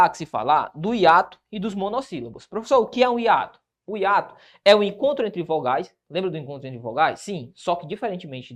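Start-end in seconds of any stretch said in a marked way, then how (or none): none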